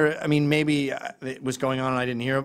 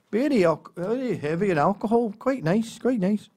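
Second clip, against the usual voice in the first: higher pitched Scottish uncle reviewing beers; lightly done Scottish uncle, pleased with himself; uncle's Scottish voice; 7 semitones higher than usual. Retold or imitated. Uncle reviewing the beer catalogue, in a higher Scottish voice. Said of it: "And he'd go".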